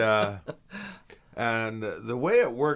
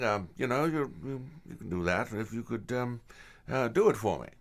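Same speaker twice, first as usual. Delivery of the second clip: Anthony Holland; 6 semitones higher than usual. Retold or imitated. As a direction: stammer out the favour like the mentor. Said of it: "he goes".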